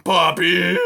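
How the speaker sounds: loud, high bellowing call. A narrator's reading. Aerith calling out for Poppy, bellowing. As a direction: bellow her name into the storm. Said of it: "he bellowed".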